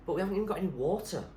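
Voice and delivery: a hint of a panicky young boy; lightly voiced boy.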